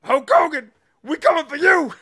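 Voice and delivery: high, loud mock threat; mock threatening shout. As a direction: yell it like a cartoon threat.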